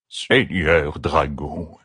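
put-on slow, trembling old servant; quavering old voice. A narrator's reading. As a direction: creak it out like a frail old man. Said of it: "dit-il d'une voix chevrotante".

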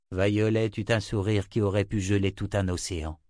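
ordinary delivery, nothing marked

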